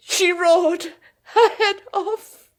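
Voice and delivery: breaking into laughter mid-sentence; laughing while telling. Acted. Edie to the visitor, laughing as she recalls her sister. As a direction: laugh through the memory, half to tears.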